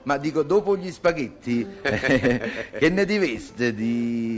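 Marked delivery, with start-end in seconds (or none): 1.8-4.4 slow teasing drawl. Acted. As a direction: drag it out, teasing. Drawn-out suggestive pause before offer.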